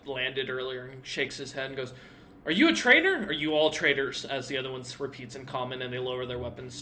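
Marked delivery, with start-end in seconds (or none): 2.5-4.2 sharp guard calling out traitors; suspicious guard. Acted. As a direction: demand it like a wary soldier. Guard voiced by game master.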